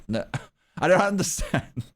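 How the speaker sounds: "I don't understand" said through a laugh; amused, baffled; laughing confusion. Real, not acted. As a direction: chuckle at your own muddle.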